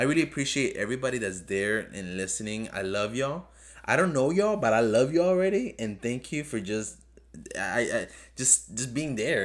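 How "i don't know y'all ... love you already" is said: excited affection. Real, not acted. Excited shout-out to listeners.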